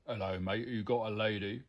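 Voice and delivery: imitated Cockney London cab driver; Cockney cabby. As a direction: put on a matey Cockney accent. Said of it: "I love it when the cabby".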